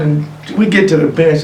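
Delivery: normal talking voice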